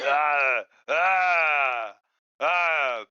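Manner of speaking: loud startled yelps; loud panicked ah sounds; panicked cries. Acted. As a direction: yelp in panic, three times.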